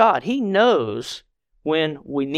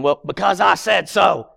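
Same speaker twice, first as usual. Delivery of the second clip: firm, emphatic parental comeback; exasperated parent. Retold or imitated. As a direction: put your foot down as the parent. Parent answering child's repeated why.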